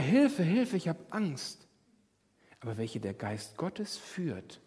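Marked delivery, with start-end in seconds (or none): 0.0-1.7 high, mock cry for help; mock panic. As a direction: ham up a little panic. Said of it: "Und dann merkst du auf einmal". (gespielt ängstlich)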